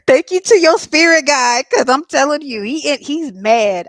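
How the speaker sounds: tickled, thanking the spirit guide; amused excitement. Real, not acted. Reader thanks the client's spirit guide, loud and amused.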